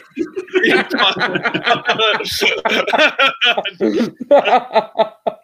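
long, high burst of laughter. Real, not acted. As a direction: crack up at your own pun. Long laugh at own pun.

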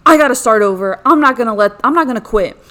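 loud, fired-up self-pep talk; loud determined resolve, refusing to quit; determined shout. Retold or imitated. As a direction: shout it like a vow to yourself. Vowing not to quit.

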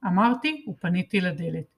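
ordinary delivery, nothing marked

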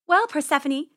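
high, loud little rabbit; uneasy, polite rabbit; nervous politeness. Acted. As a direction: excuse yourself to a predator. Rabbit nervously excuses herself to cat.

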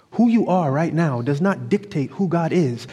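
ordinary delivery

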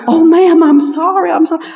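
mock the caught-out husband; apologetic husband.

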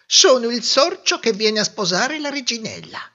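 high mouse voice, announcing himself proudly; a tiny suitor puffing himself up; proud little mouse. A narrator's reading. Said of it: "disse il sorcetto". (topolino fiero, voce acuta)

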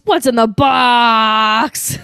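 loud, slow, drawn-out anguished yell; desperate shout. Retold or imitated. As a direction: cry it out in despair. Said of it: "he says".

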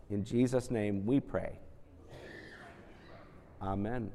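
put-on gentle children's prayer.

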